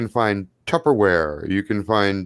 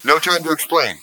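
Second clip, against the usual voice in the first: crackly phone voice; 6 semitones higher than usual. Acted.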